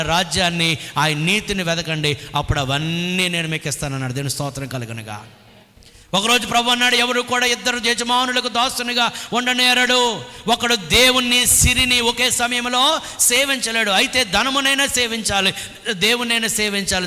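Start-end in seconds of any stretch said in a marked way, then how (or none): 6.1-17.1 louder, pitched up, declaiming the scripture; preaching at full force. Acted. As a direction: drive it home from the pulpit. Preaching with raised voice.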